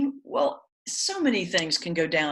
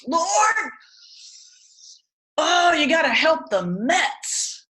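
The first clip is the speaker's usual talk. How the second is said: imitating an old man's much higher, louder, slow yell; yelling at the top of lungs; 10 semitones higher, 6 dB louder, slower than usual. Pastor retells deaf Charlie interrupting prayer, a much higher, louder mock yell. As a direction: belt it out like a deaf old man shouting in the middle of prayer. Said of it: "would yell at the top of his lungs".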